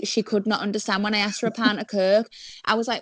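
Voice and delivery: normal talking voice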